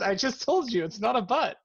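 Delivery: high-pitched mock exasperation; exasperated retort. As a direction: scold them as if repeating yourself. Acted exasperated scolding in retold dialogue.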